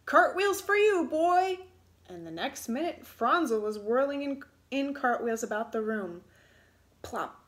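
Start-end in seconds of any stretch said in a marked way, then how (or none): 0.0-1.6 high, loud shout; shouted command. A narrator's reading. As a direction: order him to spin. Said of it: "shouted the little man".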